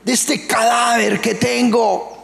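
high, slow, anguished shout; desperate shout. Retold or imitated. Paul crying out to be freed from the corpse, shouting desperately. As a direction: cry out to be freed. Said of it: "Entonces él grita".

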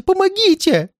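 extremely high cry for help; terrified, piercing high appeal; desperate plea. Acted. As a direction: shriek for help at the peak of panic. Old man begs for help.

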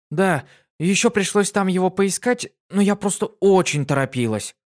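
высокий бойкий девичий голос; звонко и живо, как юная пегаска; бойкая пегаска. Acted. Дитси отвечает. (Дитси рассказывает другу, высоко и бойко)

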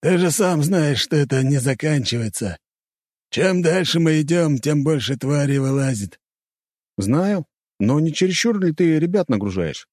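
character voice. Acted. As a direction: voice the two warriors. Dialogue voiced higher.

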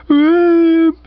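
high wailing sob, loud; young woman sobbing on the bed, loud high whoop. A narrator's reading. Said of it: "she went".

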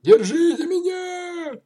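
high, slow frightened shout; panicked cry. A narrator's reading. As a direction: yell for help in terror. Boy panicking on bicycle.

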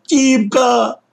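put-on high, loud desperate child cry. A narrator's reading. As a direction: scream his brother's name in despair. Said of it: "отчаянным Лёшкиным криком". (отчаянный крик)

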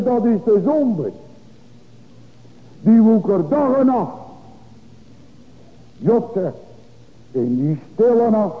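high, loud, urgent pulpit cry; full-force preaching. Acted. Preacher warning the congregation about sin, loud and urgent. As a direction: thunder it over the congregation.

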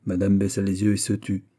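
usual speaking voice

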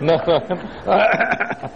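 rising chuckle at own joke; laugh. Real, not acted. Preacher amused by his belly joke, laughing.

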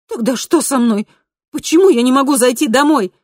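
high, urgent, building in volume; distressed questioning. A narrator's reading. Kristina asking why she cannot go home, distressed. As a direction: plead for an answer with rising panic. Heroine desperately questions her double.

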